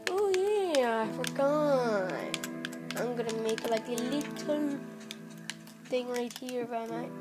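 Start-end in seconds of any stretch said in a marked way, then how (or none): none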